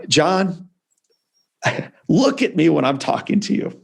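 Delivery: lightly voiced veteran dad demanding his son's attention; stern father. Father at the hospital bed telling his burned son to look up, mildly stern. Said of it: "points down at me and says".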